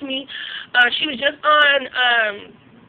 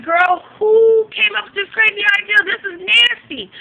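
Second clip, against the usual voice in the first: disgusted scolding; 7 semitones higher, 3 dB louder than usual. Retold or imitated. Vlogger scolding the girl in the video, high and disgusted. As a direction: tell her off in high disgusted disbelief. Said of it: "I'm like".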